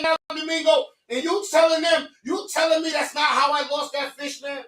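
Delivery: on the podcast, accusing his brother of costing him the fish, heated. Real, not acted. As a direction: jab at him, heated and indignant.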